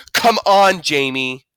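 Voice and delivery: loud exasperated mother; angry. A narrator's reading. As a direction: snap at him, fed up. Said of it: "said Mom angrily".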